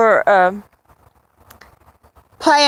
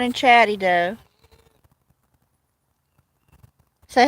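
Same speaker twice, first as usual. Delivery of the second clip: pet talk; 2 semitones higher than usual. Real, not acted. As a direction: sweet-talk the cat. Talking sweetly to cat Edo.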